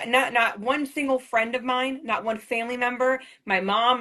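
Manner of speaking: normal talking voice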